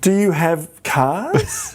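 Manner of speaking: slow, incredulous mock question; hammed-up naive outsider. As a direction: ask it like a clueless foreigner. Mocking American perception.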